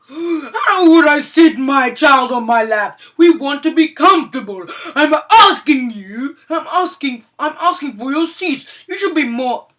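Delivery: hammed-up furious Karen; shouting entitled mom. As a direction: bellow it like she's the victim. Entitled mom shouts, first take.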